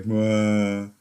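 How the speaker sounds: mock mopey Eeyore groan; teasing co-host with a glum moan; Eeyore mope. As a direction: droop it like a sad donkey. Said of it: "You're like Eeyore".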